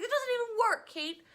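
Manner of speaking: high, loud exasperated complaint. Retold or imitated. Mom scolding Kate about the useless cat app, high and loud, exasperated. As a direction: throw up your hands at the daughter. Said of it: "I was like".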